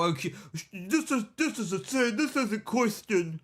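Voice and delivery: high, put-on whiny voice; mock pious complaining in a squeaky voice; mocking moraliser. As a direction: imitate a fussy scold, hammed up. Mocking people who moralise at her.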